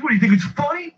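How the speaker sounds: high-pitched indignant challenge; mock irate customer. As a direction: imitate a man confronting someone laughing at him. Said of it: "it got real c-, crazy".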